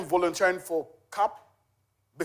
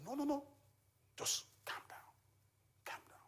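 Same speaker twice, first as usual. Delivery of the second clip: calming whisper to a crying stranger; hushed calming whisper; 9 semitones higher, 17 dB quieter than usual. Retold or imitated. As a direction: whisper it gently, over and over. Soothing a crying caller.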